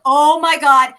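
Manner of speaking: loud alarmed oh my God; shocked aunt hearing he's in hospital; alarmed gasp. Retold or imitated. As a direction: blurt it out in sudden panic. Panicked reaction to hospital message.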